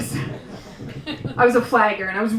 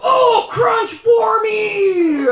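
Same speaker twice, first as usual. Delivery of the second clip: high, slow, loud swooning at a pickle; mock ecstatic shout; 10 semitones higher, 4 dB louder, much slower than usual. Comic mocking screaming at food, high and slow. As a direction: scream rapture at a sandwich. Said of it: "I'm just like".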